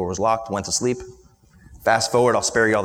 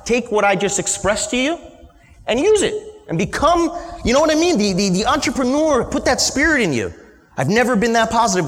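proud dad pep talk; 11 semitones higher than usual. Retold or imitated. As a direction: pump the kid up like a proud father.

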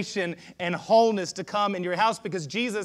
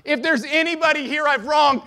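raised, high public confession; shouting to the crowd; 9 semitones higher, 7 dB louder than usual. Acted. Zacchaeus confessing to the whole crowd, shouting out. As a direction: call it out to everyone present. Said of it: "he's shouting to the crowd".

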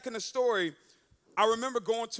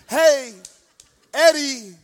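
loud, drawn-out phone confession; urgent calling out; 12 dB louder, much slower than usual. Retold or imitated. Girlfriend confessing to boyfriend on the phone, loud and drawn out. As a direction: call the name out loudly, stretching it.

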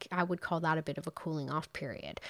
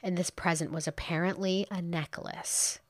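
ordinary talk

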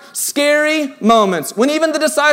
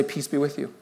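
gentle hush; 7 semitones lower, 12 dB quieter than usual. Acted. God's words quoted, hushed.

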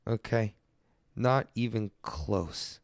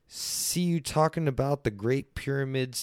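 normal talking voice

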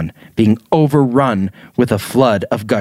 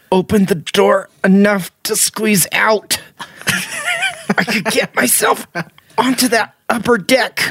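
strained inner thought; 10 semitones higher than usual. A narrator's reading.

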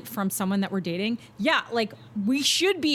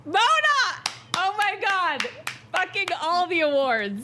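high delighted cry of her name, then gushing praise; excited shout; 10 semitones higher than usual. Real, not acted. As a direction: cheer her on at full voice. Cheers friend's comeback, shouting.

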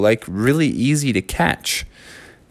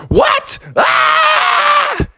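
very high shrieking cry; panicked shout. Acted. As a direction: shriek at the burning playground. Bear panics at fire.

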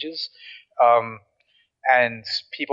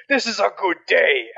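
higher-pitched, exuberant battle cry; Iron Bull; 6 semitones higher than usual. Acted. Warrior companion greeting a dragon fight, exuberant. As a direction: roar it out, relishing the fight. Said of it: "He's like".

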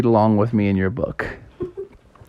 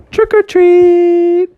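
much higher, louder, slow call; shouted trick or treat; 5 dB louder, much slower than usual. Acted. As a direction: holler it at the door. Kids shouting at door.

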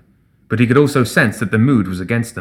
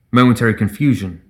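ordinary delivery

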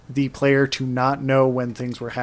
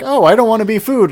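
higher, louder fretful yelp; mock panic; 8 semitones higher, 6 dB louder, faster than usual. Player fleeing a big creature, mock panicked. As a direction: play up the fear of being chased. Acted panic while being chased.

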